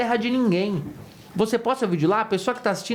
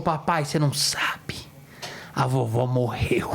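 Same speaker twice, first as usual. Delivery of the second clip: put-on child's solemn news; 8 semitones lower, slower than usual. Daughter telling news slowly.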